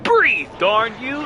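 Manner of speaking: loud slow beefy lobster shout; Larry the Lobster voice. Retold or imitated. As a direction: bellow it like a macho lobster. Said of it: "the Larry the Lobster voice in my head".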